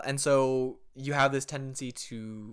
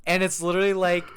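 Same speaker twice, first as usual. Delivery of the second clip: ordinary talk, nothing marked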